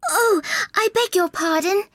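high-pitched, flustered apology; hurried regret in a high voice; hasty apology. A narrator's reading. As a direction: cry out sorry as Alice, very high. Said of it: "cried Alice hastily".

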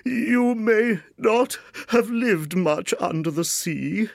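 high, slow, mournful creature voice; tearful Mock Turtle. A narrator's reading. Mock Turtle resuming his tale to Alice through tears, tearful. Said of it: "with tears running down his cheeks".

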